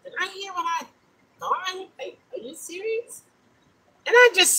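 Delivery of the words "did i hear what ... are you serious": higher and softer, can't believe it; quiet disbelief to yourself; hushed, higher "are you serious?"; incredulous inner voice. Retold or imitated. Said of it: "I'm saying to myself".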